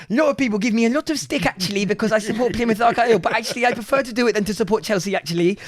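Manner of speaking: mock earnest young fan reading his letter; kid's letter voice. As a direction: read it out as his thirteen-year-old self. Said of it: "You know, like that".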